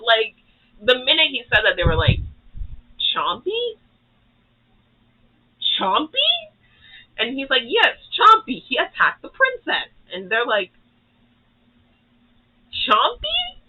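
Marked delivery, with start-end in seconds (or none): 3.1-6.3 higher, puzzled mock question; imitating baffled guards echoing the name; confused. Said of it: "They're confused". 7.7-9.9 hammed-up king pushing his lie; insistent king. 12.7-13.6 much higher squeaky mock question; a baffled squeak, rising at the end; confused. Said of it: "They're confused".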